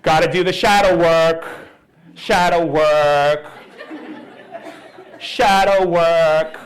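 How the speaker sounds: loud, drawn-out mocking chant; mock shadow-work devotee. Mimicking people who do shadow work, loud mock zeal. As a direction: heave out the words, loud and slow.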